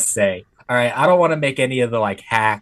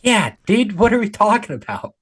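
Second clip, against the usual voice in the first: incredulous; 10 semitones higher than usual. Real, not acted. Incredulous reply to obvious question.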